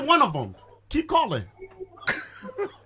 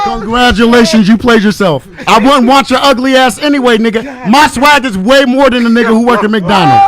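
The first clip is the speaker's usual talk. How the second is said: loud, lower and punchy, like a taunt in a fight; yelling taunt; 4 semitones lower, 9 dB louder than usual. Real, not acted. Caller yells to woman on air, loud taunting. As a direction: throw it at her at full volume.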